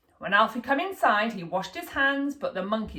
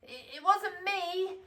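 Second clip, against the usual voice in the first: put-on slow, high, stuttering child voice; stammering little boy; 9 semitones higher, 6 dB quieter, slower than usual. A narrator's reading. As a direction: fumble for an excuse like a guilty kid.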